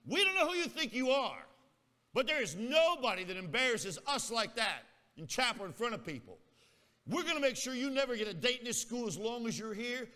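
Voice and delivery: high, loud indignant tirade; acted angry scolding. Embarrassed girl threatens student outside chapel, angry scolding. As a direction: tell him off as the girls' spokesperson.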